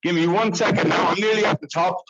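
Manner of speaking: out of breath on the stairs; talking while climbing steps. Real, not acted. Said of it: "hard to talk and walk up a lot of stairs".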